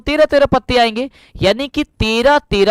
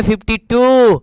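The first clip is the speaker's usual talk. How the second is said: mock English-medium answer, last word drawn out; imitating CBSE students; slower than usual. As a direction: stretch out TOO like a CBSE pupil. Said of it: "अब CBSE वाले कहेंगे". (CBSE वालों की नकल, टू खींचकर)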